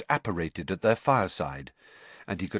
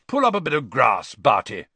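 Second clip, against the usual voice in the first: loud, high and hearty; a cheery, booming invitation; bright; 4 semitones higher, 6 dB louder than usual. A narrator's reading. Ludo inviting Barty to sit, bright. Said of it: "said Ludo brightly".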